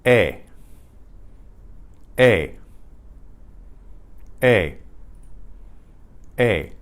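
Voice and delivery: each E a short, pure "eh"; E like "eh", separate and clear; Spanish E. Shown as a demonstration. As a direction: model E as a clean Spanish vowel. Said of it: "in Spanish is E".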